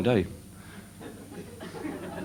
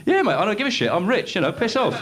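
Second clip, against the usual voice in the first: smug high dismissive brush-off; cocky barber; 10 semitones higher than usual. Retold or imitated. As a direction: shrug him off, pleased with yourself. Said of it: "I said".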